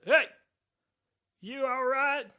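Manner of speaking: high, loud concerned call from a stranger; loud high voice of a passing stranger. Acted. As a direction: call down the alley to a hurt man.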